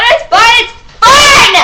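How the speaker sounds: high, loud, slow fake crying; mock wail. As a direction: whine it like a sarcastic sob. Mocking teachers' claim, whining.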